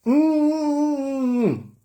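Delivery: high nasal mm of a kiss; kissing hum. Pretend. Mimicking a couple kissing, high kissing hum. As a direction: hum like lips locked in a kiss.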